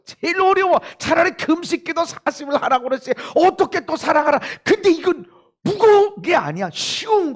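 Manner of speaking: high, loud impassioned cry; fervent preaching. Acted. Preacher urging the church to love, impassioned. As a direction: preach it with full fervor.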